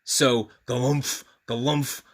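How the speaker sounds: plain reading voice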